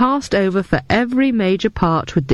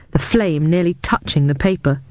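ordinary delivery

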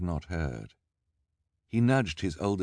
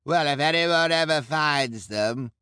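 much higher, louder crowd voice; bloodthirsty onlooker; 10 semitones higher, 7 dB louder than usual. A narrator's reading. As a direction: relish the threat. Crowd member.

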